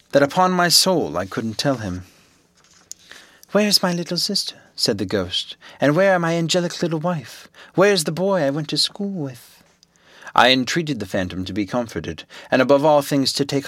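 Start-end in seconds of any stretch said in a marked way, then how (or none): 3.5-4.6 put-on ghost boy voice. A narrator's reading. Ghost speaks, narrator voices it. 5.8-9.6 put-on ghost boy voice. A narrator's reading. Ghost speaks, narrator voices it.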